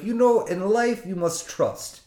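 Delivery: imitating the mechanic's life lesson; Russian mechanic wisdom. Mechanic tells the nervous driver to trust him, Russian accent. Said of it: "and he's Russian".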